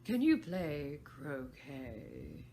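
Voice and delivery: imperious Queen. Acted. Queen addresses Alice.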